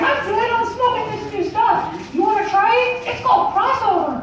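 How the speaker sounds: high falsetto cartoon voice; sky-high, chatty cartoon falsetto; Mickey Mouse. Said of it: "He's like".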